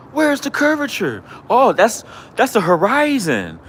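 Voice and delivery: high, mocking sing-song challenge; mock-high taunting of a rival. Real, not acted. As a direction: squeak the question right at him in mockery. Taunting the flat earth critic.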